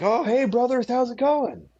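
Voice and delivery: imitating Mike's chummy hello to passing missionaries; cheery greeting.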